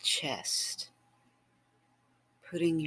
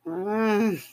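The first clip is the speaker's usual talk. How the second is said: high, louder hum of desire; a longing moan; 6 semitones higher, 7 dB louder than usual. Acted. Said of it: "I moan".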